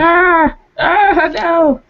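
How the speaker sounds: high, drawn-out cries of protest; startled yelp. Real, not acted. Speaker fending off an attacking cat, real high startled yelping. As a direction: yelp high as if suddenly clawed.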